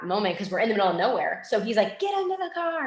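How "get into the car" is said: imitating a trainer's urgent high command; retold scream. Trainer ordering handler into car, high mock scream. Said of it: "he's screaming".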